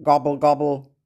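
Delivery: low, bubbling turkey call; turkey gobble. Shown as a demonstration. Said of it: "Let's hear Turkey again".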